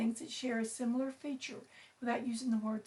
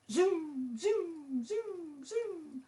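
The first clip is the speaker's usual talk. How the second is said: high, swooping zooms that fall away; rocket zooming noises; 5 semitones higher, much slower than usual. Acted. Bird pretending to be a fast rocket, playful zooming. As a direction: repeat playful falling whooshes on zoom.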